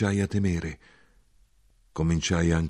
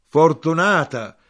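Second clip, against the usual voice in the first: high, loud, drawn-out cry; loud exclamation; 10 semitones higher, 10 dB louder, slower than usual. A narrator's reading. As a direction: exclaim it loudly, stretching the word. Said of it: "esclamò mia madre".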